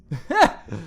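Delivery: brief burst of laughter; a quick amused huff; short laugh. Real, not acted. Amused by the surviving cannon.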